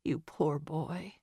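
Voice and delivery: soft, slow murmur of pity; under her breath. A narrator's reading. Missus Dover pities the confessing Quincy, soft slow murmur. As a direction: say it under your breath, slowly. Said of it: "she said under her breath".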